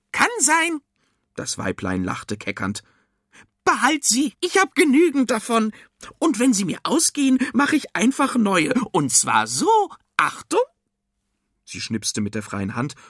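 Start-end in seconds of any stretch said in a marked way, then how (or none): 0.1-0.8 very high, offhand witch voice; perky cucumber witch. A narrator's reading. Cucumber witch answering Arthur, perky witch voice. 3.6-10.6 high, loud, boastful witch; perky cucumber witch. A narrator's reading. Cucumber witch boasting of her magic to Arthur, perky witch voice.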